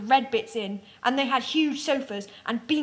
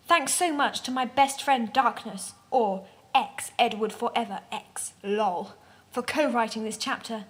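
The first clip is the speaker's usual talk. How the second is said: usual speaking voice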